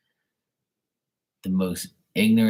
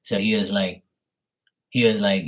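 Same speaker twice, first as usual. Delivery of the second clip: ordinary talk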